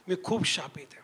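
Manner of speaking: hushed, low, nearly whispered; retold tearful confession. Preacher quoting a crying sister on the phone, hushed. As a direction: imitate a crying woman under the breath. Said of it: "रडत होती ढाळा ढाळा".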